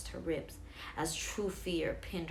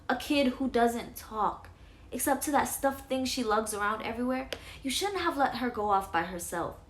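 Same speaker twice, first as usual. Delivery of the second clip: louder, frustrated mother; heated mother; 5 semitones higher, 5 dB louder than usual. Acted. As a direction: argue back, exasperated. Parents arguing.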